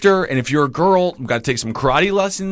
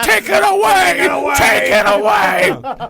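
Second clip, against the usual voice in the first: high, drawn-out comic wail; hammed-up movie outburst; mock desperate shout; 13 semitones higher, much slower than usual. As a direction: shout it high and stretch every word. Joke alternate ending of The Notebook.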